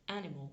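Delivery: "animal" in English, first syllable stressed; English pronunciation. Shown as a demonstration. Teacher demonstrating word stress to learners, English "animal" stressed on AN. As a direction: say "animal" the English way, AN-i-mal, with the ending fading. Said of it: "l'accent tonique est sur ma première syllabe".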